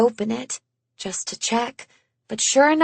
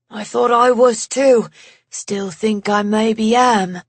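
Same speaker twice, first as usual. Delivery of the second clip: lightly voiced English man, rueful; a hint of an English accent, wry; light English accent, bleak humour; 4 dB louder than usual. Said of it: "that English accent of his".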